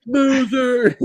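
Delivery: high, loud drawn-out heckle; mock jeer. Heckler calling out at a golf match, loud high mock taunt. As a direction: golf fan taunting the guys in pants or shorts, high mocking jeer. Jeering at players in shorts.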